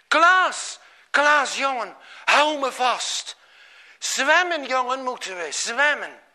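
loud, high urgent pleading; urgent calling. A narrator's reading. Skipper holds Klaas in the waves, urgent loud plea. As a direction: urge him to hold on and swim.

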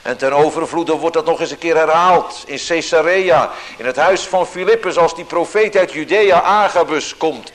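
loud, high, driving preaching; vurig preken. Acted. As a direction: build up the urgency of Paul's journey. Preaching with rising force. (luid en gedreven preken)

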